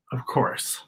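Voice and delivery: a breathy stage whisper, half to herself; mock whisper. Host whispering to the kids at home, soft conspiratorial whisper. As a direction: let the kids in on a secret with a hushed aside.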